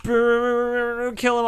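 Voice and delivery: gleeful shout. Pretend. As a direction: yell it gleefully at the targets. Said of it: "I really like making the laser noises".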